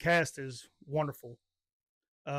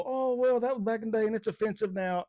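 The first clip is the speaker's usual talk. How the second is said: mock complaint, pitched up and strung out; complaining objectors; 5 semitones higher than usual. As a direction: put on a higher voice for the naysayers. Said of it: "all them people who sit there and go".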